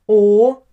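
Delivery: "O" as a pure vowel, no English glide; isolated, emphatic German "O". Shown as a demonstration. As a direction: hold "O" steady and round, German style. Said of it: "not the same as the English one".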